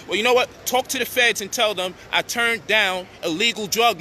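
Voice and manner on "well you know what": ordinary talk, nothing marked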